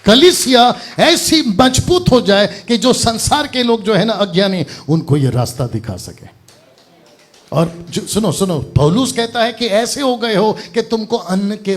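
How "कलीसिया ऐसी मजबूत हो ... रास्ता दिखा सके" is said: loud, high, emphatic exhortation; forceful preaching. Real, not acted. Preacher urges the church to be strong, loud and forceful. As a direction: proclaim it with full pulpit force.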